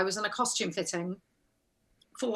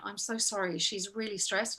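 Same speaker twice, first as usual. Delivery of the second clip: soft, slightly lower aside; hushed apology; 3 semitones lower, 10 dB quieter than usual. Retold or imitated. Assistant apologising to the actor for her boss, hushed. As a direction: imitate a discreet, sympathetic apology.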